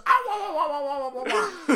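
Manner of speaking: high, loud wah wah babble; laughing mock wail; Mario wailing. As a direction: wail like a panicked cartoon plumber. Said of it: "he's shrieking and then he goes".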